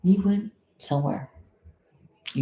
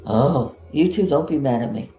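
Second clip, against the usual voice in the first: usual speaking voice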